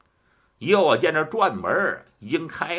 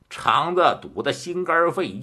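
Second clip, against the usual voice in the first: ordinary delivery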